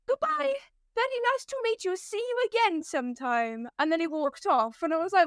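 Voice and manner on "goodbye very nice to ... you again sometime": high opening farewell in mock German accent; heavy German accent. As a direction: imitate a German man's polite goodbye. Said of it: "very heavy German accent".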